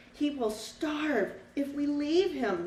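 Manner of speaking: slow and earnest, the little bird insisting; cardinal's voice. Acted. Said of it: "the cardinal said".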